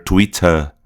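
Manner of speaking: "Twitter" British, crisp T, no R; non-rhotic British accent. Shown as a demonstration. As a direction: end British "Twitter" on a weak vowel. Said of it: "vai substituir o R do americano".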